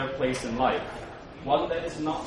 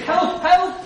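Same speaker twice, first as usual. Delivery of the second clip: very high, very loud panicked cry; screaming for help; 11 dB louder than usual. A narrator's reading. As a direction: scream it like a terrified master. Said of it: "The master screamed".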